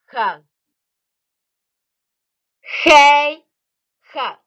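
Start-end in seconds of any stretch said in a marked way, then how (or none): 2.6-4.3 Khê then khờ, the letter name and then its bare sound; teaching the K sound slowly. Shown as a demonstration. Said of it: "và phát âm là".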